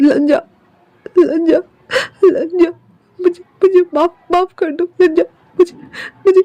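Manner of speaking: crying out the name, breaking down; sobbing calls. Acted. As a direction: call the name through tears. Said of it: "और कहती है".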